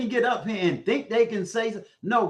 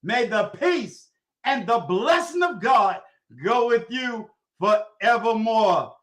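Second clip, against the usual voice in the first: solemn benediction; 2 semitones higher than usual. Acted. Uncle blessing Maggie's viewers, solemn benediction. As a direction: send them off with God's blessing.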